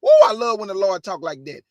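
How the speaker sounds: ordinary talk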